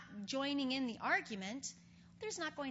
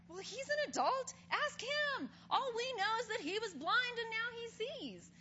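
evasive parents; 10 semitones higher than usual. Retold or imitated. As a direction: pass the question off nervously. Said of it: "the parents are just like".

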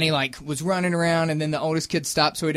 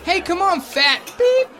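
high mock yell of a cheeky kid; imitated kid yelling; 12 semitones higher, slower than usual. Dad retelling a kid's rude playground taunt, imitated yell. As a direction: call it out high like a yelling child. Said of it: "they're yelling, and then one was like".

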